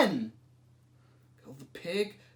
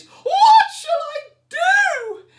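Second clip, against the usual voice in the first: put-on very high, loud wailing old lady; crying old lady; 9 dB louder than usual. A narrator's reading. Old lady wails to the wise man, high and loud. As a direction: wail it like a frantic little old lady. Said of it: "The little old lady cried".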